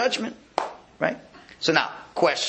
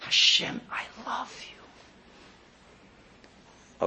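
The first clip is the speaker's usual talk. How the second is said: weary, whispered, drained; tired whisper; 7 semitones higher, 5 dB quieter than usual. Retold or imitated. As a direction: sigh it out after a long hard day. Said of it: "you're tired, and you just go".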